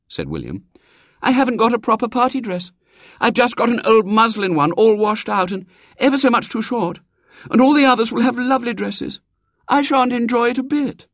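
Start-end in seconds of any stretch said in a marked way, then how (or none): 1.2-11.1 put-on high, plaintive girl's lament; a girl fretting over her dress; complaining little girl. A narrator's reading.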